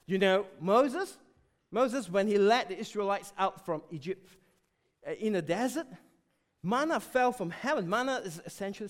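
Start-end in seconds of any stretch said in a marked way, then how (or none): none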